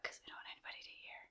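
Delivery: hushed, quick whisper. Real, not acted. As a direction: whisper it like a secret aside. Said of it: "Why are we whispering?".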